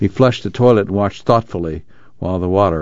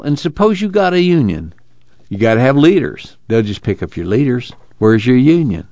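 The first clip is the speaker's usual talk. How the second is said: higher-pitched, doubting farmhand; 5 semitones higher than usual. Acted. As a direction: challenge the union idea with lifted pitch.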